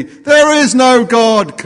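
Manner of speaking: high, loud, drawn-out retort; neighbour yelling back. Acted. Neighbour answering the old lady's praise, slow defiant yell. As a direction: holler it back, stretching each word. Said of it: "responds the neighbour".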